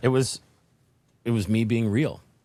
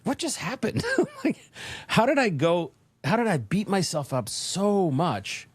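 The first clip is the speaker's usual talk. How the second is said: much higher, amazed and laughing; grinning disbelief; 8 semitones higher than usual. Retold or imitated. Speaker alone after the standing ovation, amazed and grinning. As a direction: marvel at your own success, higher. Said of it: "grinning, like".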